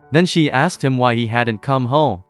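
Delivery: ordinary delivery, nothing marked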